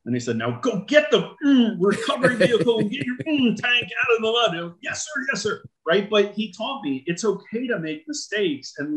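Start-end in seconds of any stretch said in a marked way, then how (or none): 0.4-4.7 commander's order. Retold or imitated. Commander orders tank recovery. 4.8-5.6 much higher, quick, eager yes sir. Retold or imitated. Young lieutenant answers his commander, high and eager.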